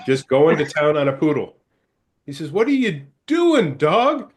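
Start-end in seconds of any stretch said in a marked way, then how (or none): 2.5-4.3 high, drawn-out, louder disbelief; acted incredulous outcry. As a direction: demand an answer from the misbehaving dog. Owner finds dog misbehaving in joke.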